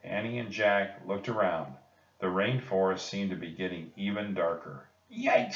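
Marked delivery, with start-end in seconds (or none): 5.1-5.5 high startled yelp; yikes. Acted. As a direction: yelp in sudden fright. Annie frightened by darkness.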